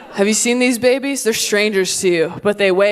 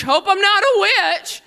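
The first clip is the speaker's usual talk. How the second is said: put-on worried medieval kid; 9 semitones higher, slower than usual. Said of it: "You're like".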